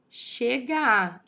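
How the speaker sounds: "Chega a" slow and loud, one long final a; vowels fused. Shown as a demonstration. Teacher showing how two vowels merge, vowels fused. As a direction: let the two a's of "Chega a" melt together. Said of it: "meio que se funde junto com o outro".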